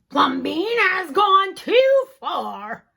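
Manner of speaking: loud, slow indignant outburst; outraged neighbors. Acted. Neighbors fed up with Plumbean's house, loud outrage. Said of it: "neighbors outraged".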